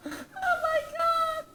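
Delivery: high, slow, dazed and laughing; high dazed sedated voice; drugged wonder. Retold or imitated. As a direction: marvel at a numb face, high. Sedative kicking in, face numb.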